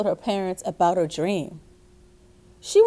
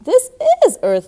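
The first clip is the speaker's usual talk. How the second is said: high, louder, a patient mother correcting; mother's voice; 9 semitones higher, 7 dB louder than usual. A narrator's reading. Mother telling little May this is Earth, high and louder. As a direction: mom gently pointing out the obvious. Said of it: "her mother said".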